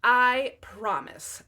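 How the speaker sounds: loud, very slow insincere promise. Acted. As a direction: promise it, dragging it out. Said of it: "the princess assured him".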